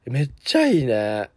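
ordinary talk

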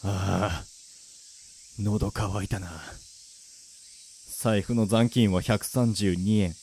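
low, subdued inner narration; luckless man's monologue. Acted. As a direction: narrate your own misery, parched and worn out. Down-on-his-luck hero's monologue. (疲れた男の低い独白)

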